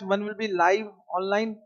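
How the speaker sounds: ordinary delivery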